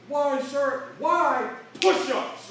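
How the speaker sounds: loud barked drill exchange; drill barked order. Retold or imitated. Recruit questioning drill instructor, then loud barked order.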